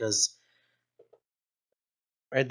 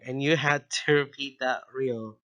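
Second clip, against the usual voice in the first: usual speaking voice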